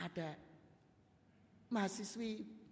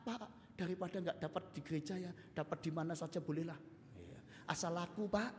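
pleading quietly; 8 dB quieter than usual. Acted. Churchgoer pleads to pastor.

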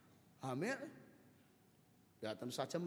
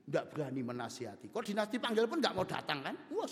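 usual speaking voice

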